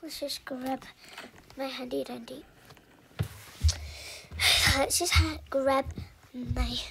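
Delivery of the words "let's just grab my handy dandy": whisper. Real, not acted. Whispering to camera.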